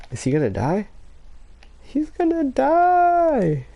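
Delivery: ordinary talk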